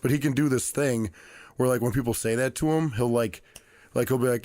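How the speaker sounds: ordinary talk, nothing marked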